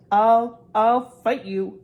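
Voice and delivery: put-on scared boy forcing himself to be brave; nervous bravado. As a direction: Neville blocking the portrait hole, stammering bravely. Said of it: "steeling himself for something desperate".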